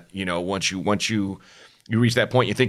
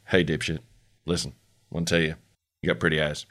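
romantic cowboy; 6 semitones lower, 4 dB quieter, faster than usual. Retold or imitated. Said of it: "you sounded like a, like a, a romantic cowboy".

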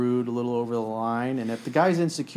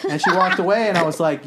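laughing; 6 semitones higher, 7 dB louder than usual. Real, not acted. Laughing while retelling.

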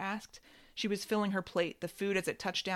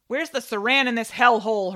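loud complaint; 5 semitones higher, 12 dB louder than usual. Acted. Sister complains loudly.

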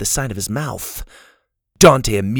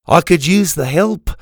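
higher, louder character voice, mildly grudging; reluctant; 6 semitones higher, 5 dB louder than usual. A narrator's reading. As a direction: admit it with a touch of reluctance. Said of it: "the man reluctantly admitted".

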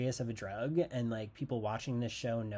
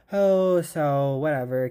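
exasperated outburst; 3 semitones higher, 12 dB louder, slower than usual. Real, not acted.

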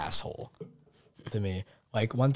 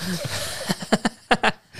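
high burst of laughter; 11 semitones higher than usual. Real, not acted. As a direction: laugh high and bright after the punchline. Laughs after retold insult.

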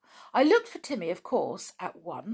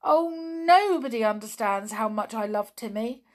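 a touch of loud, higher-pitched distress; groaning in distress; 5 semitones higher, 7 dB louder than usual. A narrator's reading. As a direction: lament it with feeling. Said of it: "put her head in her hands and groaned".

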